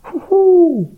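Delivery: high, loud owl hoot; long, swooping owl call. A narrator's reading. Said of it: "heulte die Eule".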